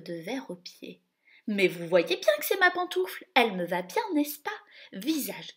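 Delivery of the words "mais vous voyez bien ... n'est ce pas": higher, loud pushy whine; whiny stepsister. Acted. Anastasie to the duke, whiny insistence. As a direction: insist it fits, fooling no one.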